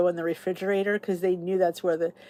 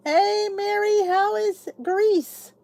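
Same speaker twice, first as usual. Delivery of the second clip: higher, slow singsong hello; cheery greeting; 12 semitones higher, much slower than usual. Real, not acted. Greeting a viewer who is travelling in Greece, higher singsong. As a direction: sing out a bright hello.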